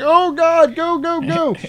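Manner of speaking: high mock-terrified cry; hammed-up falsetto panic; panicked urging. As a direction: hurry someone away from danger. Mother urges son to flee.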